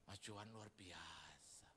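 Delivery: slow, soft amazed whisper; soft slow whispered praise; awed whisper. Retold or imitated. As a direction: whisper in awe. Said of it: "Dia bilang".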